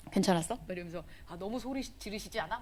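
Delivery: a churchgoer murmuring complaints to a friend, hushed; hushed gossip. Retold or imitated. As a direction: whisper it like sharing a secret. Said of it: "막 이제 이러면서".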